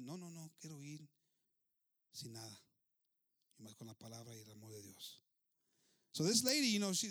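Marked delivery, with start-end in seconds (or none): 0.0-5.2 soft refusal, sinking lower; quiet resolve. Acted. Widow turning down an army escort, quiet resolve. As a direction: decline softly, growing lower and quieter.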